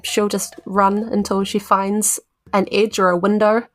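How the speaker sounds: normal talking voice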